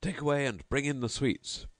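host calling his servants for the sweets; crying out. Acted. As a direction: clear away and call for sweets. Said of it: "So the entertainer cried".

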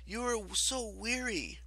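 high, slow, gentle coaxing; kind, sympathetic. A narrator's reading. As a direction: lull the goddess with sympathy. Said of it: "her voice radiating kindness and sympathy".

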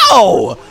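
punchy mock cat cry; cat-like yowl. Shown as a demonstration. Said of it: "moves just making you go".

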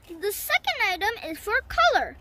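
ordinary delivery

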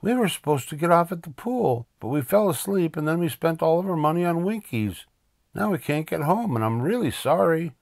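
high, breathless-sounding run of excuses; pleading boy Arthur. Acted. Arthur explaining to the driver, pleading. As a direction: pour out the confession high and apologetic.

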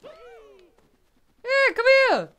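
high, loud calling out; coaxing call. Real, not acted. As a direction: call the creature over like a pet. Calling to game creature.

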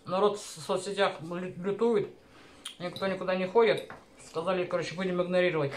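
speaking between bites; talking while chewing. Real, not acted. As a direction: mouth partly full of food. Seen 3: Eats forkfuls and chews near the mouth while talking, then speaks clearly.

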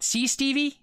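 high-pitched eager confiding; a father eagerly winning his boy over. A narrator's reading. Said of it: "he whispered eagerly".

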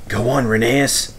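ordinary delivery

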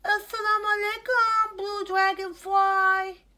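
put-on a gentle young hippo calling his friend; Hilmi the hippo. Hilmi greets again.

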